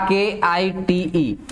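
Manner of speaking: केआईटीई spelled out letter by letter, slowly; slow spelling. Shown as a demonstration. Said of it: "इसमें bite और kite बनता है".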